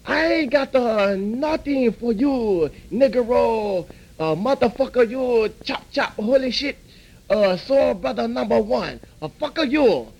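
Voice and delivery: the owner snapping back in a broad put-on Chinese accent; angry mock Chinese accent. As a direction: scold the rude customer in the mock accent.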